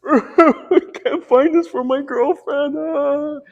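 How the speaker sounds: high, slow, loud blubbering falsetto; hammed-up tearful falsetto; mock crying man. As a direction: wail it like a grown man bawling. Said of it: "starts crying".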